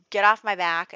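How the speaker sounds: loud, snapped get off my back; angry shout. Retold or imitated. Friend snaps at her brother, a loud angry shout. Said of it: "going to argue back".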